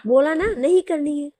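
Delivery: fed-up, forceful no; irritated refusal. Acted. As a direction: shut the question down sharply. Snappish refusal to take the call.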